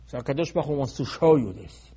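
ordinary delivery

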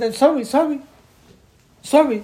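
high, loud pleading sorry; pleading apology. Retold or imitated. People begging forgiveness, high and pleading.

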